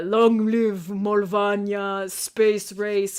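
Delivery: slow, drawn-out mock Moldovan accent; hammed-up Moldovan patriot slogan; fake Moldovan accent. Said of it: "talk in a fake Moldovan accent".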